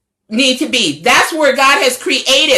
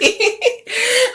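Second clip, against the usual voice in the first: light laugh; 11 semitones higher, 4 dB quieter than usual. Real, not acted. Laughs at her own rhyme.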